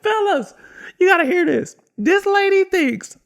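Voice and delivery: high, loud holler to the guys; rally everyone for the gossip; calling out mockingly. Worker calls his coworkers to mock a customer, hollering.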